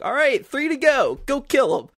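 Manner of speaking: loud mock video-game announcer. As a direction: ham up the cheery game voice giving orders. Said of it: "it was like".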